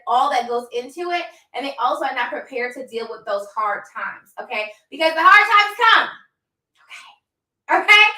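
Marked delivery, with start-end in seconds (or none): 4.9-8.0 emphatic. Real, not acted. Warning entrepreneurs of hard times.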